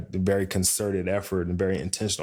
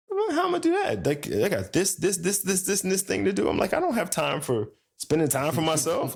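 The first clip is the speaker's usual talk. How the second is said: much higher, overwhelmed mock protest; incredulous protest; 11 semitones higher than usual. Man told to take time for himself, incredulous protest. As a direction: squeal it out as if swamped with chores. Acts out protest at wife's advice.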